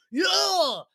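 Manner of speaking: high imitated yell; mock scream. Coworker shouting to another employee, mock yell. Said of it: "when you hear somebody screaming".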